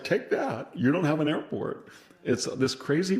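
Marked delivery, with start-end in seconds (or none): 0.0-1.9 much higher, boastful jeer; mock bravado taunt. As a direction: taunt as if you're winning while losing. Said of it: "it's like".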